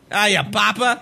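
high, put-on British greeting; mock British accent. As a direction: pitch it up in a silly British voice.

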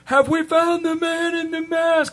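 high, slow voice over plane noise; yelling over engine noise; CIA agent voice. Retold or imitated. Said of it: "and he's like".

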